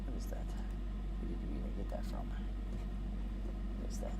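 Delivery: hushed, breathy aside; whispering. Real, not acted. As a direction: whisper it under your breath. Puzzled aside, off mic.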